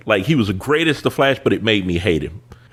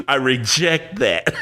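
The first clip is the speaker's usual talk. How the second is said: high laugh, slow laughing refusal; 5 semitones higher, slower than usual. Real, not acted. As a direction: laugh it off and reject it. Laughing off the comparison.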